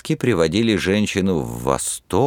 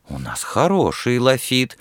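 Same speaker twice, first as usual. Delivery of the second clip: offended; 7 semitones higher than usual. A narrator's reading. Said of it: "обидчиво возразила девушка".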